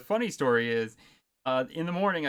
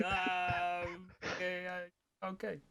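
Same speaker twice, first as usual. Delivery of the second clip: awkward trailing okay; 3 semitones higher, 8 dB quieter, much slower than usual. Retold or imitated. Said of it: "Yeah, I was like".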